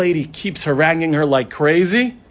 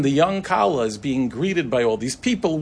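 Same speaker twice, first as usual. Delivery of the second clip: ordinary delivery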